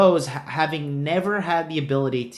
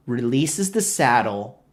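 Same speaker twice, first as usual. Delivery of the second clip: ordinary talk, nothing marked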